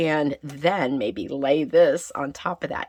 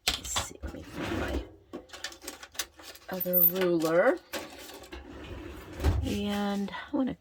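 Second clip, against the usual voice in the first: whispering; 5 semitones higher, 5 dB quieter than usual. Real, not acted. Quietly talking to herself while crafting.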